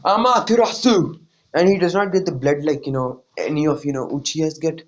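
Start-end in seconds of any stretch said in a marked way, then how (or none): 0.0-1.2 attack call. Retold or imitated. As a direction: call out the technique. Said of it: "he goes".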